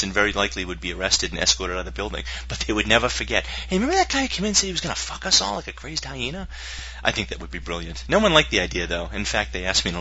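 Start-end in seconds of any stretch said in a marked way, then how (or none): 3.5-6.5 higher-pitched incredulous recollection; imagined bystander. Acted. Prospect remembering the guy at the meeting, incredulous. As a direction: play an amazed witness retelling it.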